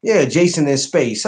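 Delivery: ordinary delivery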